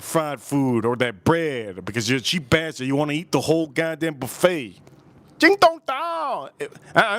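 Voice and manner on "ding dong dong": mock gibberish. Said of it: "the gibberish".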